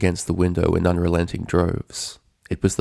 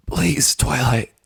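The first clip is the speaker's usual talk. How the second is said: higher, slow, panting plea; pleading Rainbow, breathless; 6 semitones higher, much slower than usual. A narrator's reading. Captive pony begging Twilight through heavy panting. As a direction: gasp out the plea between breaths. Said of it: "managed through her heavy panting".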